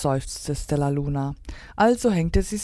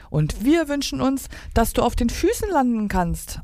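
higher-pitched acted reply; little bird voice; 8 semitones higher than usual. A narrator's reading. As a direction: answer back brightly as little birds. Birds answer Stella Luna in story.

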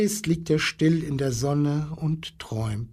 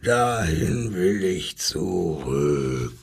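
low, slow lion voice; deep, drawn-out lion's declaration; lion crying out; 8 semitones lower, slower than usual. Acted. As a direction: rumble the longing slowly like a lion. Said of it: "schrie der Löwe".

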